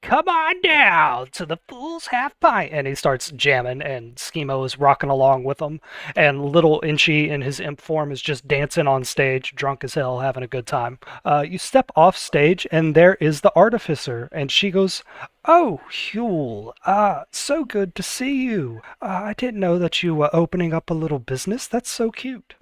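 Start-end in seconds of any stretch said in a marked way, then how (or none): 0.0-2.7 high, drawn-out glam rock wail; slow, high-pitched glam rocker voice; eighties glam rocker. Acted. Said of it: "eighties glam rocker voice". 15.4-22.6 high, sugary socialite hello; higher, cooing delight at an acquaintance; gushing greeting. Acted. Artificer greets Huel, gushing.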